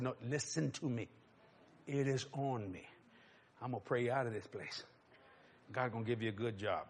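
low, hushed, earnest address; quiet, intimate pastoral voice; solemn blessing. Retold or imitated. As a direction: speak softly and gravely to her alone. Retells promising her a blessing.

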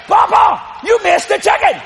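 put-on high, loud boyish outcry; mock protest. Little boy to grandpa after the miss, loud mock protest. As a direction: call it out high in disbelief.

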